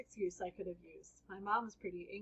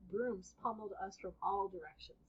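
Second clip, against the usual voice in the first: plain reading voice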